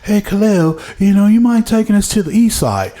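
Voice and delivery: put-on British accent. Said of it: "he's a Brit. He's, he's an English dude".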